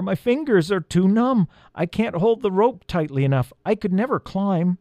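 high, despairing voice; cry of despair. A narrator's reading. Numb elf cannot climb, cry of despair. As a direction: call up in defeat, pitched high. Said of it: "cried Leolin in despair".

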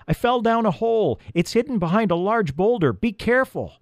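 high, urgent calling voice; yelling. A narrator's reading. Elf warns Peter about the hidden hole, yelling. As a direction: call up a warning, pitched high. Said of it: "she yelled".

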